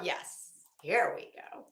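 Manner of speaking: normal talking voice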